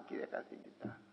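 "Κύριε καθηγητά" whispered, breathy with reverence; awed whisper. Shown as a demonstration. Preacher mimicking reverent whisper to the professor. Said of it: "σε πιο κύριο καθηγητά".

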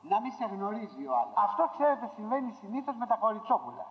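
usual speaking voice

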